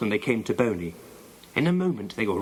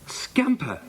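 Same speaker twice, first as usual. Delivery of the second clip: high-pitched cry of amazement; amazed; 11 semitones higher than usual. A narrator's reading. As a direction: call the dog's name in high, astonished disbelief. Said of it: "said Janet in amazement".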